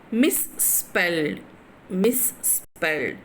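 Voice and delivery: slow "Misspelled", parts held apart; "Misspelled" very slowly, each time falling at the end; syllable by syllable. Shown as a demonstration. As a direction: draw out "Misspelled" piece by piece. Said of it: "So three syllables".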